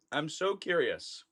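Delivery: hushed echo of the thought; quiet aside. Real, not acted. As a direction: drop to a murmur to yourself. Repeats the line twice.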